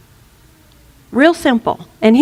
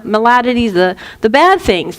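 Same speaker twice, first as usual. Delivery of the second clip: ordinary talk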